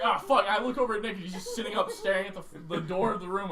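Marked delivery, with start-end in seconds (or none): 0.0-0.5 pained curse. Retold or imitated. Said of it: "was like".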